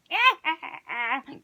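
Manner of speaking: put-on old crone's jeering cackle; hag cackle. A narrator's reading. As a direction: cackle at the coward. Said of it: "The old hag laughed".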